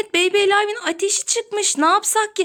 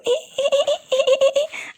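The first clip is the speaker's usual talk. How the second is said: high doll giggle; 8 semitones higher than usual. Pretend. Doll laughs, mother voices it.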